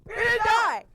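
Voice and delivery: high, loud shout of relief; elated relief. Retold or imitated. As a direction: burst out yelling that you survived. Retold landing, then shouted take.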